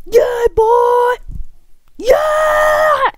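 very high, loud victory whoop; triumphant yell. Real, not acted. Player celebrating a kill in the game, triumphant yell. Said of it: "Sorry for yelling into the mic".

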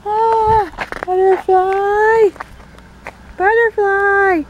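high, slow, dreamy wonder; a hawk-brained kid spotting something shiny; giddy high butterfly wonder; distracted by butterfly.

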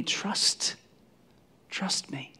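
soft, intimate whisper; hushed reassurance. Acted. Jesus reassuring troubled disciples, whispered and soft. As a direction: whisper it to comfort them.

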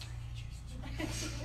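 breathless whisper, high; whispered prayer. Retold or imitated. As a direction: whisper thanks at the altar. Wife praying at altar.

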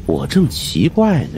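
usual speaking voice

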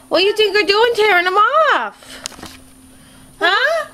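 playful high-pitched telling-off; high mock scolding. Real, not acted.

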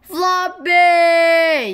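long, high, loud cry of a name; desperate yell. Acted. Kipper crying after the stolen dog, yelling. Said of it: "yelled Kipper".

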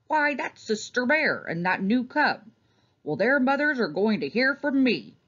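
a touch of outrage at the apple thieves; indignant farmer. A narrator's reading. Farmer Ben's angry dialogue.

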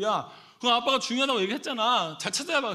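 high, loud dad telling-off; exasperated scolding. Retold or imitated. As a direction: scold your child in disbelief. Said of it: "기가 막혀가지고".

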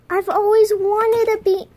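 gasp, then high, loud delight; hammed-up thrilled outburst; pretend overjoyed. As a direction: overcome by a make-believe gift. Gasping over a surprise gift.

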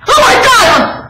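high, slow, loud panicked cry; mock panic. Wedged treadmill in the doorway, she cries out in mock panic. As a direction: wail it in hammed-up alarm. Panicked exclamation in retold story.

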